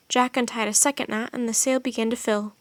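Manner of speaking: plain reading voice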